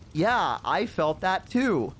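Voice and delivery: drawn-out humouring reply; scared fake agreement. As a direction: drag out an insincere yes. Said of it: "like, a little scared now".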